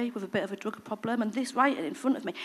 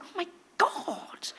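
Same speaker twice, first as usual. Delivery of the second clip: breathless whispered oh my God; hushed realisation; stunned whisper; 8 semitones higher, 5 dB quieter, much slower than usual. Retold or imitated. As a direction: whisper it in shock, slowly.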